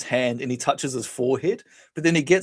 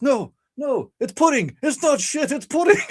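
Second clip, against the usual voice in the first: high-pitched, hammed-up frantic protest; frantic denial; 13 semitones higher than usual. Roy explaining the lump to his date, frantic. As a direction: imitate a man desperately explaining himself. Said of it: "he realizes and he goes".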